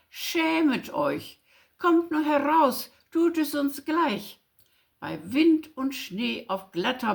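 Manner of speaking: put-on rousing recital of an old winter rhyme; poem recitation.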